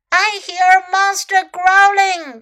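high, loud storybook exclamation; alarmed cry. A narrator's reading. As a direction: cry it out as the scared dragon. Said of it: "cried Dragon".

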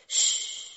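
long unvoiced shush; hushing "shh". A narrator's reading. Chick hearing distant sobs, urgent shush. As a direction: hush the others to listen.

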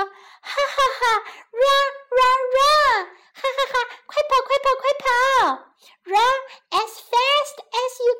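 high gingerbread man with acted ha-ha; laughing taunt. A narrator's reading. Gingerbread man runs from the woman, laughing high taunt. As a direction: taunt gleefully in a high creature voice. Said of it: "一边哈哈哈地笑着说".

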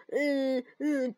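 usual speaking voice

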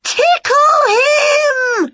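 high, loud, very slow scream. Acted. Witch screaming at the wrestlers, very slow and loud. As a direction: stretch it out as a screech. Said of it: "she screamed".

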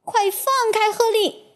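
high, urgent shout. A narrator's reading. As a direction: cry out to free him. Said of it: "克里斯汀大声喊".

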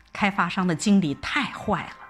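ordinary delivery

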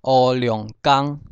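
乌龙江 as "oo-liông-kang", slow; Hokkien reading. Shown as a demonstration. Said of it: "从第一个黑龙江开始".

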